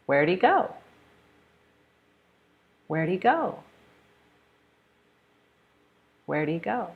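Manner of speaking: "Where'd he go" with the h dropped; dictation of reduced forms. Shown as a demonstration. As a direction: blend "Where'd he" together. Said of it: "I'm going to say the sentence".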